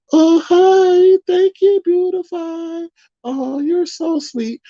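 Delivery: very high, syrupy voice, stretched slow; fake sweet. Streamer mocking a rival's fake sweetness, high and syrupy. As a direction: gush like a fake-nice flatterer. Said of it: "she comes on all".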